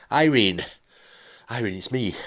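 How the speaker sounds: a frightened husband gasping down the phone; husband in a panic calls his ex-wife, gaspy and raspy; gaspy distorted husband. Acted. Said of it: "moved up an octave to a very gaspy, raspy, distorted voice".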